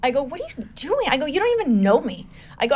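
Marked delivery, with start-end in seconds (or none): 0.2-1.1 hushed, high-pitched disbelief; incredulous. Retold or imitated. Her to a near-stranger handing over cash, incredulous. 1.2-2.2 high-pitched, astonished protest; incredulous. Retold or imitated. Her to a near-stranger giving money, incredulous. Stunned at stranger's cash gift.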